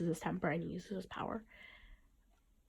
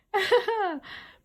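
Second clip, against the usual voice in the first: loud high laugh; burst of laughter; 13 semitones higher, 7 dB louder than usual. Real, not acted. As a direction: break into a big laugh. Laughs at own joke.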